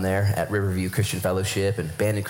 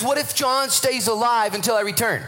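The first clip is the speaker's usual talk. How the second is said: higher, dry sarcastic retort; Jesus gently mocking Peter, higher and dry; 11 semitones higher than usual. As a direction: tease him with a knowing jab. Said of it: "He says something completely sarcastic".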